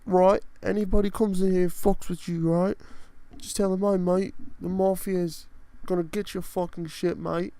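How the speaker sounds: high, loud put-on English mobster accent; hammed-up English gangster voice. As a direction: lay on a thick English tough-guy accent. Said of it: "I just got English for a second".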